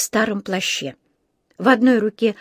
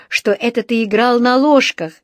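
ordinary delivery, nothing marked

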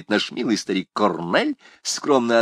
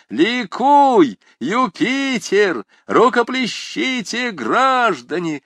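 loud, high, slow chant of acclaim; cheering shout; 12 semitones higher, 6 dB louder, much slower than usual. A narrator's reading. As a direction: cheer like a rowdy student crowd. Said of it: "закричали шкалеры". (ликующий крик школяров)

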